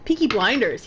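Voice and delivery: ordinary delivery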